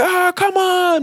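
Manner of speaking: high, drawn-out group complaint; mock exasperation. Back rows complain about the argument, mock exasperated. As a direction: imitate a fed-up crowd, slow and high.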